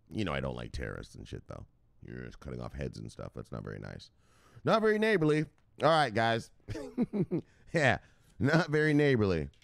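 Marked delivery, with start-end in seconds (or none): 0.1-4.1 much lower, much softer aside; host walking back a bad joke, much softer; sheepish mutter. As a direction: mumble it like you regret saying it.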